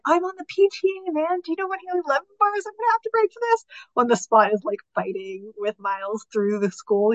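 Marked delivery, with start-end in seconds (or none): 0.0-3.6 higher, fast worked-up dad voice; playing an indignant dad who protests; Miles's dad. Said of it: "Miles's dad is like".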